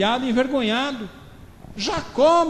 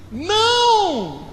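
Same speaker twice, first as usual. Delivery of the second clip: high exclaimed no; 7 semitones higher, 4 dB louder than usual. Acted. Jesus stopping the servants, high exclaimed no. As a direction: cry out no in surprise. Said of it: "tá até com exclamação".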